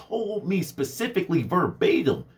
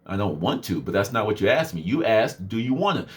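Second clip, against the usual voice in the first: sarcastic literal; 9 semitones lower than usual. Retold or imitated.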